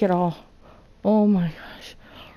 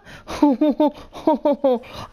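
high, nervous laughing wail; panicked laughter; 4 semitones higher, 4 dB louder than usual. Real, not acted. Player just ambushed by attackers, panicked laughter. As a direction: laugh helplessly after a fright. Startled by zombies in game.

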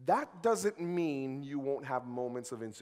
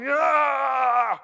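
mock fight yell; 9 semitones higher, 12 dB louder than usual. Acting out a fight.

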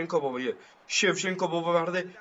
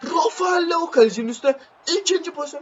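much higher, louder commentator cry; excited shout; 12 semitones higher, 5 dB louder than usual. Real, not acted. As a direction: shout it as the striker bears down on goal. Commentating a big chance. (heyecanla bağırarak)

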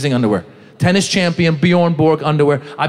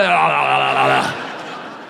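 babbled gobbling, dropping very low; frantic gobbling noise; 4 semitones lower, 3 dB quieter, much slower than usual. Acted. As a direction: imitate wolfing down food noisily. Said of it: "pounding fruit".

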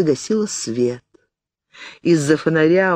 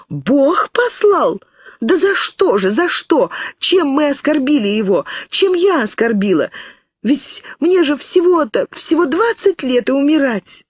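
put-on high, crying outburst like a child; girl sobbing and crying out nonsense in despair; sobbing hysterically; 11 semitones higher than usual. A narrator's reading. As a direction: wail it out like a frightened child. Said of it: "Она рыдала, выкрикивая бессмыслицу".

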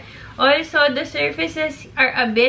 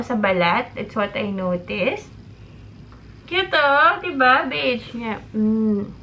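muffled through fabric mask; speaking through a face mask. Real, not acted. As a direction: voice behind covered mouth. Seen 0: Mask covers mouth and nose throughout, put on around -2.

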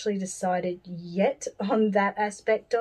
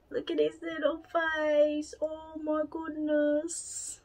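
tender cooing; 8 semitones higher, 5 dB quieter, slower than usual. Real, not acted. Cooing over the doll's face.

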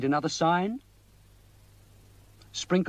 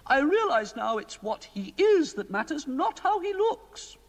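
high, fussy queenly voice; a worried queen pleading with the wizard; 11 semitones higher than usual. A narrator's reading. Queen complaining to Mister Ben about the king, high queenly voice.

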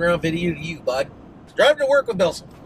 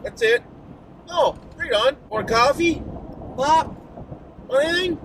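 bright, much higher mock-welcoming neighbour; friendly host; 11 semitones higher than usual. Greeting strangers in his backyard, high cheerful host voice. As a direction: play the easygoing guy offering coffee. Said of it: "Just come out and say".

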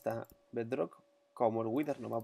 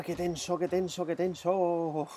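tense excitement; 4 semitones higher, 4 dB louder, slower than usual. Real, not acted. After tense fight.

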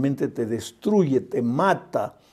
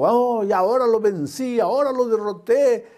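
higher, taunting devil voice; a lightly voiced, higher gloating devil; 7 semitones higher than usual. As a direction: gloat like the devil over a victory he thinks is won. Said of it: "el diablo dijo".

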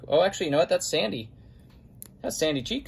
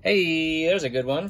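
usual speaking voice

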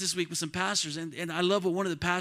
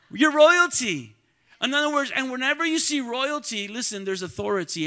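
ordinary talk

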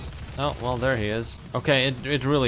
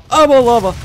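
higher, louder panicked yell; louder panicked shout; 12 semitones higher, 15 dB louder than usual. Real, not acted. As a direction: scream it in rising panic. Panics standing on lava.

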